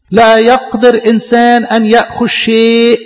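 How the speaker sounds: slow, measured verse reading; solemn scripture recitation. Acted. Preacher reading a verse to his congregation, slow and solemn. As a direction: intone the verse slowly and gravely.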